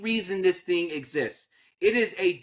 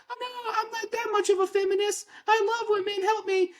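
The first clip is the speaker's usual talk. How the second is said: very high, fast, pleading mock falsetto; mocking whiny voice; faster than usual. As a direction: squeal it like a helpless damsel begging for money. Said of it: "And the W N B A is like".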